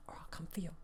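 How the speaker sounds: soft, mock-threatening whisper; menacing whisper. Host mock-threatens toxic gamers, whispering.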